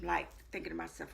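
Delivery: ordinary talk, nothing marked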